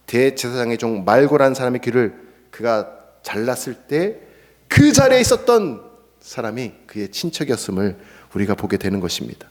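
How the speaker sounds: ordinary talk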